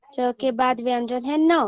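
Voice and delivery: ordinary talk, nothing marked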